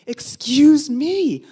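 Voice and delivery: loud, drawn-out indignant call; retold affronted protest, loud and slow. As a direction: stretch it out high and affronted. Retold confronting the honking driver.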